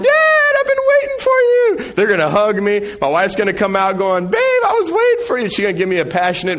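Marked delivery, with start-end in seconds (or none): 0.0-1.9 put-on high, loud kid voice calling dad; little kid shouting for daddy; excited kids. Kids run out greeting dad. 4.3-5.5 sweet wife. Retold or imitated. Wife greets husband in ideal fantasy.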